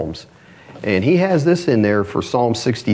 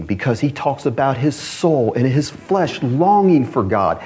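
ordinary delivery

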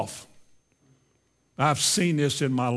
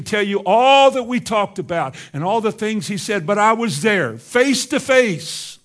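higher-pitched, emphatic pulpit declaration; impassioned preaching; 5 semitones higher, 5 dB louder than usual. Acted. Preacher declaring Paul's calling to the congregation, impassioned. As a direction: preach it with firm, rising conviction.

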